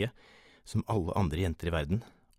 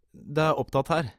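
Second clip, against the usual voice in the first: high, loud, careful shout; cautious call; 9 semitones higher, 6 dB louder than usual. A narrator's reading. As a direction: call out warily through a door. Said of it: "ropte jeg forsiktig". (forsiktig, lyst rop)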